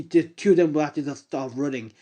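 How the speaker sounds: plain reading voice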